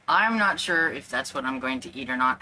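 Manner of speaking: normal talking voice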